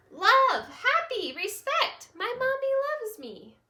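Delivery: put-on high, loud excited children calling out; eager little kids. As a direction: burst out answers like happy little kids. Little critters answer eagerly.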